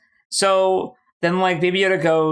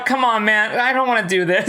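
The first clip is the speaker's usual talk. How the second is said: higher-pitched, put-upon pleading; mock reluctant pleading; 7 semitones higher than usual. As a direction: plead half-jokingly like a reluctant hero. Acting out reluctant good guys pleading.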